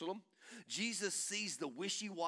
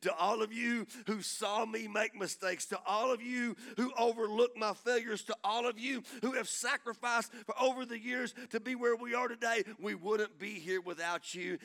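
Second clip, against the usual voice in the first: louder, higher preacher's thanks; impassioned preaching; 5 semitones higher, 6 dB louder than usual. Real, not acted. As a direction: preach gratitude in rhythmic, building phrases. Preaching at full force.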